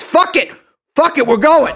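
high, strained shouting tantrum; mock furious outburst. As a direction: blow up in a hammed-up on-air rage. Acted outburst about doing it live.

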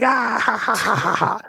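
high mock "ha ha ha" laughter; imitated giggling fit. Retelling to a friend how he was dying laughing, high giggles. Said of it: "I was dying".

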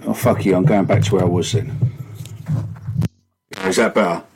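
muffled echo like underwater; sounding like from a submarine; echoey underwater voice. Real, not acted. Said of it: "really echoey".

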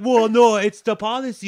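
mock flustered phone clerk. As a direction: parody of the stammering clerk. Mocking the clerk's reply.

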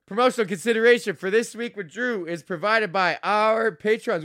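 booming promo announcer read. Shown as a demonstration. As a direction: read it like a radio ad. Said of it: "redone sponsor read, announcer voice".

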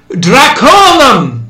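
high, loud, slow cry; shouted. A narrator's reading. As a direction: bellow it at the crowd. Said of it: "the American shouted".

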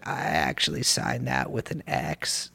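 low, quiet vocal fry impression; Liz impression. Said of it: "let me do my Liz impression".

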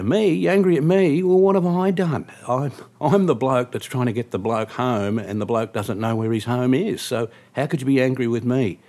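loud, high-pitched affronted outburst, easing slightly; indignant protest. Retold or imitated. Cab driver defending himself to an angry passenger, indignant. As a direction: protest your innocence loudly.

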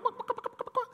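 very high mocking chicken clucks; squeaky mock chicken noises; chicken clucking. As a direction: cluck like a chicken to call him a coward. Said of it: "I was like".

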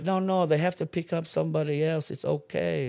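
high, lightly voiced wife calming him; a hint of a calming wife; mock soothing wife. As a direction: imitate a wife's high reassuring voice. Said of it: "she's like".

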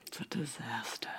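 whispered, soft and deflated; defeated whisper. Shown as a demonstration. Whispering the show title back, defeated. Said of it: "You sounded so defeated".

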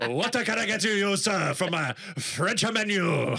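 hammed-up French waiter, slow and drawn out, laughing through it; mock French accent with a giggle. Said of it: "It's a French accent".

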